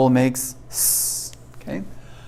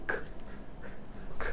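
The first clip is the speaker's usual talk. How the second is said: usual speaking voice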